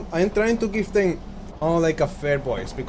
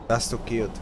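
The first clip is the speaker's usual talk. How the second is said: ordinary talk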